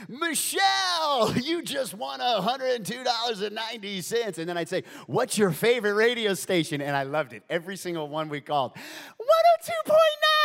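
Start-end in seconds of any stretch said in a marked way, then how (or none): 0.1-4.4 high, loud game-show prize announcement; hyped radio host crowning a winner. Acted. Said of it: "I'd put on my D J voice". 5.1-6.8 loud, high radio host prompt; big on-air question. Acted. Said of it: "I'd put on my D J voice". 9.2-10.4 very high, loud imitated winner; hammed-up high-pitched shriek; excited scream. Imitates winners screaming station name.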